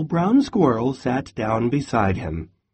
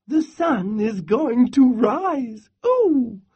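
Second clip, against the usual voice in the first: much higher squeaky squirrel, squealing ooh; excited squirrel; 11 semitones higher than usual. Acted. Squirrel answers Bear, high with a squealing ooh. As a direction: chirp it out hopeful and eager.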